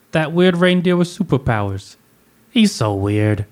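a playful mock Russian accent. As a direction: do Florence Pugh's Russian accent lightly. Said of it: "in her little Russian accent".

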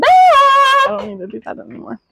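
high, sing-song silly voice. Real, not acted. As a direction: pitch it way up, playful. Repeats line after "What?.

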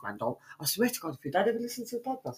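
ordinary talk, nothing marked

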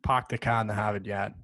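Boston accent, "Park" as "Pahk", "car" as "cah". Shown as a demonstration. Boston student demoing his accent to a Californian friend. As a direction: drop the r's, Boston style, "Hahvad yahd". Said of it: "It's very Boston".

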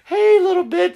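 high, loud, laughing puppy voice; cutesy baby talk. Retold or imitated. Talking sweetly to the female dog, high giggle. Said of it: "We're not gonna".

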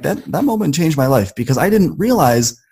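usual speaking voice

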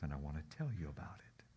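hushed whisper of a confession. Acted. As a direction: whisper it tenderly to her. Husband tells his wife softly.

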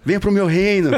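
high-pitched comic devil; devil's enticement. Retold or imitated. Devil inviting him into his realm, high and theatrical. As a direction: put on a beckoning tempter voice. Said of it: "o capeta começou a tocar flauta".

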